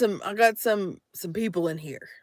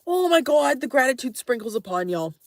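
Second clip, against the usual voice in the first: ordinary talk, nothing marked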